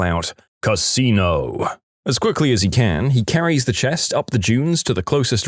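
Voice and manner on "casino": slow, drawn-out command from above; booming voice. Acted. Voice from above directs the lucky man, slow booming. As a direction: stretch it like a mock boom from the heavens. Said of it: "hears the booming voice yell".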